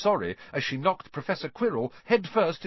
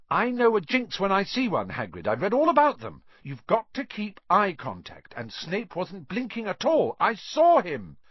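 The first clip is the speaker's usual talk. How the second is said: high, loud, emphatic arguing; high-pitched indignant argument; heated insistence; 8 semitones higher, 5 dB louder than usual. A narrator's reading. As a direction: insist you know what you saw. Hermione argues heatedly with Hagrid.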